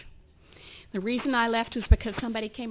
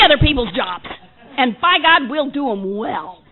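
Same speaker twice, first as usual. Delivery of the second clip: climbing louder and higher with each line; building rant; 4 semitones higher, 9 dB louder than usual. Real, not acted. Mocks her own workaholism to the room, getting louder. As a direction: pile it on, louder and louder.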